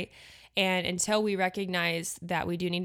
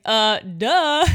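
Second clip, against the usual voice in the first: mock duh; 4 semitones higher, 10 dB louder than usual. Real, not acted. Pointing out the obvious to listeners.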